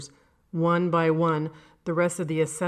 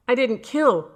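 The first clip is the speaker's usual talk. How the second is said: stammered; 7 semitones higher, 7 dB louder, slower than usual. A narrator's reading. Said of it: "Tisdale stammered".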